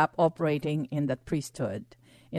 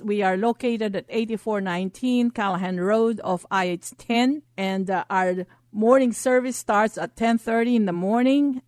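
ordinary talk, nothing marked

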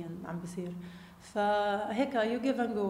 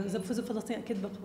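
normal talking voice

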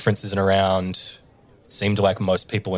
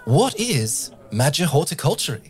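bright host question, pitched higher; Tabaxi host voice; 7 semitones higher, 4 dB louder than usual. Acted. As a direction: ask it like a showy emcee. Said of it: "And she says".

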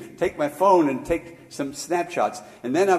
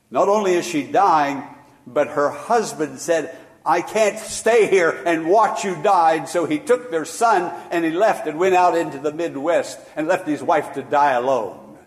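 raised, louder preaching voice; 4 semitones higher, 6 dB louder than usual. Acted. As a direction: preach it out with rising force. Preaching about abandoned dying woman.

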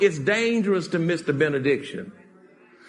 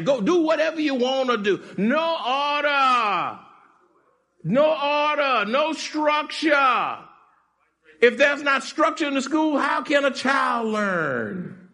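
fiery preaching; 6 semitones higher than usual. Acted. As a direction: build to full preaching fire. Preaching rising to full force.

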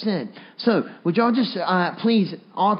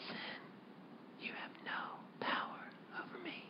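whisper; 12 semitones higher, 26 dB quieter than usual. Shown as a demonstration. Preacher showing a private reminder in temptation, whispered. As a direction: whisper it so no one even has to hear. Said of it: "you could just whisper it".

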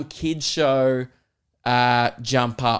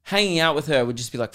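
normal talking voice

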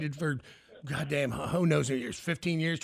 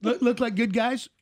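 loud question; 6 semitones higher, 6 dB louder than usual. Real, not acted. Loud slow question on air.

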